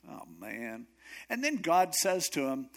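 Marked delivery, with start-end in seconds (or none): 0.1-0.9 soft, low, crestfallen groan; deflated sigh. Acted. Elijah learns he will be replaced, deflated. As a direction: sigh it out in mock defeat.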